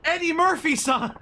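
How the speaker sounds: high, loud, slow starstruck cry of the name; starstruck shout. Acted. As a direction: call out Eddie Murphy-san like spotting a celebrity. Mac spots star, drawn out.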